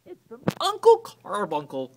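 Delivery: excited high cry; cried out. A narrator's reading. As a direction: cry out with delight. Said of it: "cried Cousin Elwood".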